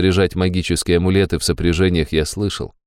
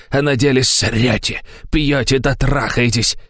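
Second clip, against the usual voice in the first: high, raging hysterical tirade; hysterical rant; 5 semitones higher, slower than usual. A narrator's reading. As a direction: rave at him furiously. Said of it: "как понесло".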